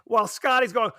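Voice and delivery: ordinary talk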